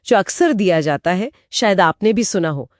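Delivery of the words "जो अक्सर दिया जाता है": ordinary talk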